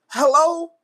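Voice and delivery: high, loud incredulous "Hello?"; a stunned, rising call of disbelief. Real, not acted. Rant at LeBron's turnover.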